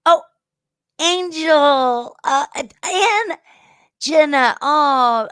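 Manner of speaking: high, bright call of greeting to friends; thrilled to see friends pop into chat; excited shout-out. Real, not acted. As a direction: sing out their names, delighted. Excited shout-outs to arriving viewers.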